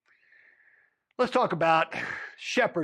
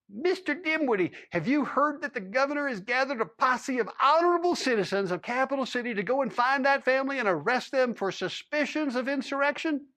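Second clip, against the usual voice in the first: a higher, pressing official's voice; lightly voiced higher lawman interrogating a cowboy; stern lawman; 7 semitones higher than usual. A narrator's reading. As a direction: lean in like a lawman demanding answers.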